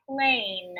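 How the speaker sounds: plain said alone with a long A; syllable said apart. Shown as a demonstration. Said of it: "I'm gonna say the long A".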